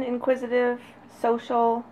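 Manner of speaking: usual speaking voice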